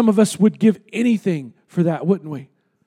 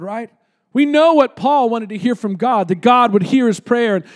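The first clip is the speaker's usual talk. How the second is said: usual speaking voice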